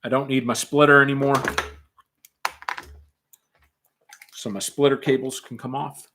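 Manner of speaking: muffled behind a mask; through a gas mask. Real, not acted. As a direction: speak through a respirator. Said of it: "gas mask is on".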